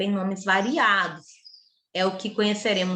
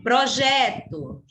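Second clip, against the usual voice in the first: "Projeto" slow with a strong, long JE; stretched JE in "Projeto"; stress on JE; 3 semitones higher, much slower than usual. Shown as a demonstration. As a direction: lean hard on the middle syllable of "Projeto". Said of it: "Gé é a sílaba tônica".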